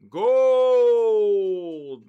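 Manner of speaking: a big high, long-held yell; excited shout. Real, not acted. Trader shouting as the gold price jumps, full yell. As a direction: bellow the word out in triumph.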